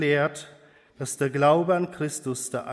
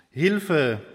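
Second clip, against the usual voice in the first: mildly raised call for help; 4 dB louder than usual. A narrator's reading. Man at the cliff edge crying for help, mildly called out. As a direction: read as a restrained cry. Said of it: "rief der Mann".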